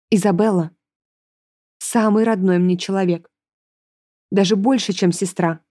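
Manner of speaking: measured plea with weighty pauses; understated solemn sincerity; serious. A narrator's reading. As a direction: say it earnestly, pausing between phrases. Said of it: "серьёзно сказала ему".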